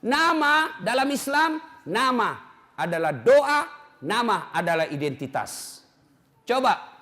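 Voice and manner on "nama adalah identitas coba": ordinary delivery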